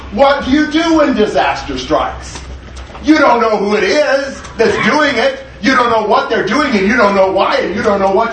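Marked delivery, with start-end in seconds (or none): none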